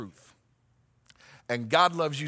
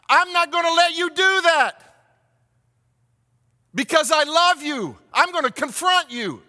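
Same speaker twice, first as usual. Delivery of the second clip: pleading shout; 10 semitones higher, 8 dB louder than usual. Acted. Friend confronting an addict, shouting loudly. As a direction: yell the truth at someone you love.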